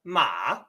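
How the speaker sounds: Ma dipping down then rising again, third tone; 第三声. Shown as a demonstration. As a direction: let Ma fall and climb back up. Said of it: "The third has the combination".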